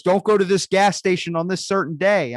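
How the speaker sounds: high, loud mock warning shout; urgent warning. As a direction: call out a frantic warning from the future. Future self warning over the phone.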